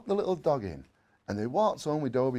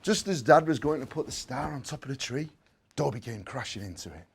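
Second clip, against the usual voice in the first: ordinary delivery, nothing marked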